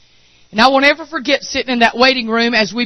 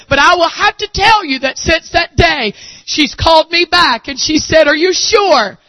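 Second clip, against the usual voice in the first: preaching at full force; 7 semitones higher than usual. Acted. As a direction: thunder it out to the congregation.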